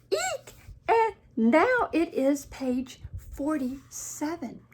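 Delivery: high squeal, then slow alarmed announcement; storybook panic, drawn out; Gerald. Acted. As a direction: squeak and count in dismay. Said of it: "he panics".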